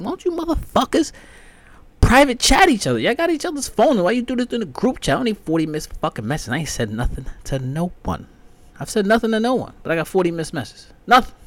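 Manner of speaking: high, loud exasperated rant; mock rant. Ranting at his family's group chat, exasperated and loud.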